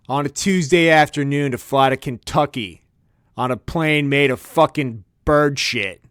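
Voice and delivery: normal talking voice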